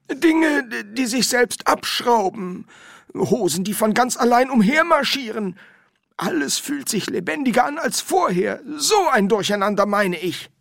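high, loud put-on character voice; high-voiced, emphatic explanation; insistent Windel. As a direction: list the oddities insistently, high-pitched.

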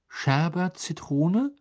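lilting, unsure guess; tentative guess. A narrator's reading. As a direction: offer a password as a hesitant question. Trying out a guessed password.

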